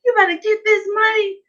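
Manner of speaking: high, breaking with excitement; excited husband. Retold or imitated. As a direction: urge her on, thrilled and squealing. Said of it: "he was like".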